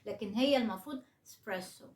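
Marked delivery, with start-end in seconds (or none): 1.3-1.9 "إسبريسو" as spresso, opening e almost gone; light unstressed e. Shown as a demonstration. Teacher showing how it should be, "إسبريسو" with a faint e. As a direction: weaken the first e of "إسبريسو", stress press. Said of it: "الإي خفيفة قوي".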